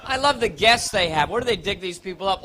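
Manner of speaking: usual speaking voice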